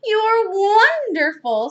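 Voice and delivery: slow, high, loud praise; delighted gushing. A narrator's reading. Missus Alma hugging the maid, delighted. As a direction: gush it with a big hug.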